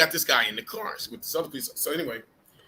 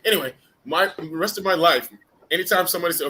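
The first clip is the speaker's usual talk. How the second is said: ordinary talk, nothing marked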